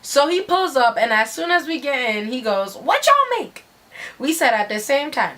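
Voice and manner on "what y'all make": very high, singsong question; hammed-up squeaky mimicry of his question; high-pitched mock inquiry; imitated driver. Imitates the driver greeting them.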